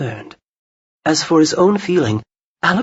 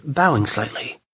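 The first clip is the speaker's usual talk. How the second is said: plain reading voice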